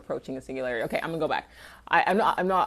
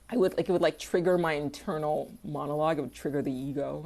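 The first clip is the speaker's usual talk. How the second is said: usual speaking voice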